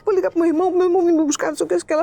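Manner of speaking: high-pitched, insistent run of protests; retold panic, words tumbling out; imitating panicked refusal. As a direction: mimic a frantic friend refusing to budge. Frightened friend refuses to go along.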